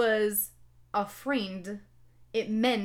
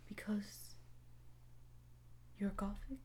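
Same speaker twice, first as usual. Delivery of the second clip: timid whisper, very soft; little afraid voice; 16 dB quieter than usual. Acted. Snape asking why, in a little afraid voice. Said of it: "asked in a little afraid voice".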